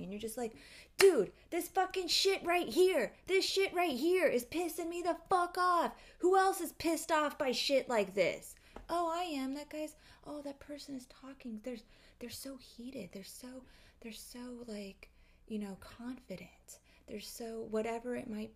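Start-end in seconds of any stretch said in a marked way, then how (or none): 1.0-8.5 loud, high outraged yelling; furious shout. Retold or imitated. Acting out an outraged poster. 8.9-17.7 hushed, starstruck follower; awed whisper. Retold or imitated.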